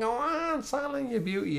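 high-pitched mock Scouse cheer; Carragher's Scouse accent. Football pundit cheering on TV, high hammed-up Scouse accent. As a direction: squeal it out in a put-on Scouse accent. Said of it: "me Scouser accent, I just always go on like Carher".

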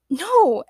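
high, emphatic "No". A narrator's reading. Agnes denies James's doubt with an emphatic "No". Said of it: "Agnes Gertrude said emphatically".

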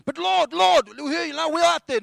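high, loud, pleading objection; agitated protest. Acted. Disciple protesting to his Lord, agitated. Preacher acts Peter's protest to Jesus.

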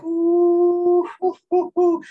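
long high "ooh", then short hoots; loud falsetto whoop of excitement; hyped whooping. Retold or imitated. Fan reacts to "we are one" reveal, loud high hooting. Retells reaction to episode cliffhanger.

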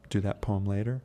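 ordinary talk, nothing marked

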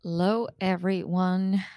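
normal talking voice

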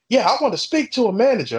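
high-pitched imitation of an indignant customer; mock demanding customer. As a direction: mimic a customer making demands. Mocks the demanding customer following him.

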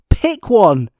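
loud, exasperated "Pick one" with a falling end; exasperated command. Real, not acted. Ranting at the filmmakers about eighteen formats, loud and exasperated.

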